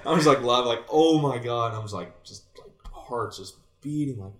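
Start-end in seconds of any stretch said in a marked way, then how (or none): 0.9-1.7 louder and a touch higher, stunned; overwhelmed exclaim. Retold or imitated. Player reliving the big moment, loud stunned exclaim. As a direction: let it burst out in disbelief.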